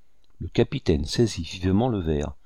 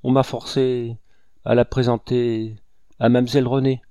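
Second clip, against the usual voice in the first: raised voice, broken by pauses; halting confession; 3 semitones higher, 4 dB louder than usual. A narrator's reading. Cornered woman playing for time confesses, haltingly. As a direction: stall between short phrases. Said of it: "en hachant ses paroles comme pour gagner du temps".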